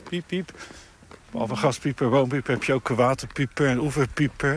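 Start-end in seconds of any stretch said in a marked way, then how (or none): 0.1-0.5 short high soft peeps; Piep, piep as two light bird peeps; bird call imitation. Shown as a demonstration. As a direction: peep like a pipit's call. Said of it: "vooral de roep ook".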